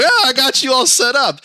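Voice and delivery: high, loud mock call-centre voice; cheery agent.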